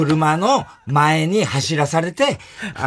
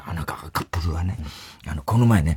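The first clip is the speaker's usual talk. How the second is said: low, halting retold confession; imitated Matsuoka confession; 8 semitones lower, faster than usual. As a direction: drop low and confide it haltingly, imitating him. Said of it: "実は松岡が". (低い声でぼそぼそ打ち明ける物真似)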